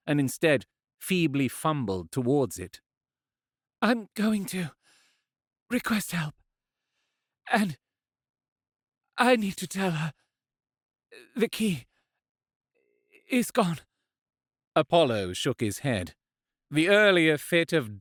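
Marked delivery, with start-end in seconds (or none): 3.8-13.8 lighter, higher woman's voice, weak but determined; resolute injured woman. Acted. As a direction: speak through pain but stay firm.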